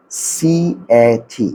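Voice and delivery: সিএটি as three long letter names, C, A, T; spelled letter names. Shown as a demonstration. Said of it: "আমরা কিন্তু normally বলি সি". (সিএটি টেনে টেনে বানান করে বলা)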